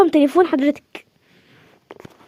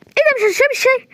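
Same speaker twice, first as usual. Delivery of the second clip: much higher startled question; surprised protest; 10 semitones higher than usual. Acted. Hostess surprised her guest is leaving before tea. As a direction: catch the guest before she leaves.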